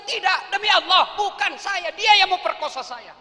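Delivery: high, loud sworn denial; pleading denial. Acted. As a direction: swear innocence loudly. Yusuf swears innocence. (sumpah membela diri dengan keras)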